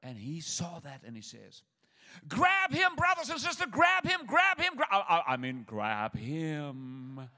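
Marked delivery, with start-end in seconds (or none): 2.3-5.3 much higher and louder frantic yelling; panicked shout. Retold or imitated. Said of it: "tried to reverse to his full pulpit voice". 5.3-7.3 very slow, forced solemn preacher with a laugh; back to pulpit voice. Retold or imitated. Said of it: "tried to reverse to his full pulpit voice".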